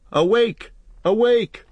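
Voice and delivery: high, loud call of alarm; alarm cry. A narrator's reading. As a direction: cry it out high to rouse everyone. Horn-call alarm cried out in story.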